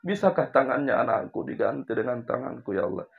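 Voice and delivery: soft, broken plea of a mother; mother's tearful plea. Retold or imitated. Mother pleading to God for her sick baby, tearful and quiet. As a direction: beg God quietly through tears. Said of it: "Uminya menangis lalu berkata".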